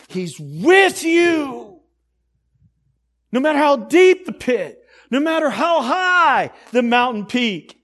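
high, loud, stretched-out key words; climactic preaching shout. Real, not acted. Preacher promises God's presence in pit and peak, climactic shout. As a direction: belt it out as the sermon's peak.

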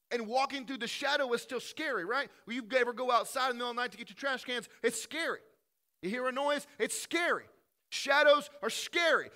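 rising pulpit intensity; full-force preaching. Acted. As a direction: preach it out to the back row.

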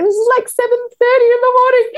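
fast, high, loud gush; high-pitched excited retelling; high excited quote. Retold or imitated. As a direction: rattle it off thrilled. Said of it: "he turns around and says".

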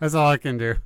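laughing through the words; giggling at her own bad impression. Real, not acted. To her co-host, admitting defeat while laughing.

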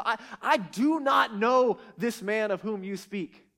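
slow, loud and deliberate, every word weighted; stern, formal, hammered-out denial; emphatic denial. Retold or imitated. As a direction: spell it out with force. Peter's emphatic final denial.

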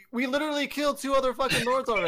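loud disbelieving complaint; exasperated rant. Real, not acted. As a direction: vent at the absurd plot. Ranting about plot.

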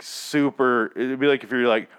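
usual speaking voice

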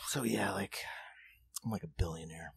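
put-on low, near-whispered drunk boast; drunk bar blowhard. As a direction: imitate a drunk bragging very quietly. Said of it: "he's just drunk".

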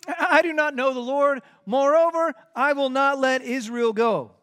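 proud refusal, ringing out; defiant Pharaoh. Acted. Pharaoh defies Moses.

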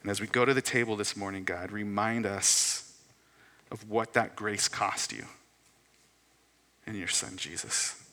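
prayer. Real, not acted. Pastor praying for the congregation before the table, prayer. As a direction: address God humbly, letting phrases settle.

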